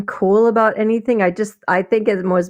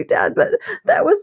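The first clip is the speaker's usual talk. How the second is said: normal talking voice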